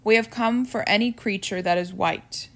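plain reading voice

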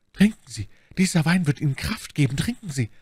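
high command, easing down; insistent urging. A narrator's reading. Milady hands Bonacieux the poisoned wine, insistent urging.

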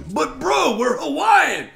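hammed-up insistent bro talk; mock Hawaiian bros. Guy mocking Hawaiians who pester him, loud surfer-bro voice. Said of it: "I'm like".

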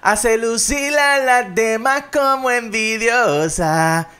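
slow, high, loud declaiming; dramatic recital. Acted. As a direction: recite it like a grand poem. Reciting song lyric dramatically.

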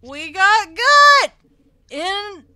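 high loud slow yell of shock. Real, not acted. Gamer yelling as her character gets impaled. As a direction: bellow the shock out.

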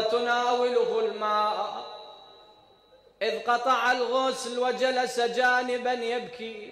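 loud, slow chanted mourning; lamenting recital. Acted. Preacher recites Ali washing Fatima, loud chanted lament. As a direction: chant the washing scene with grief.

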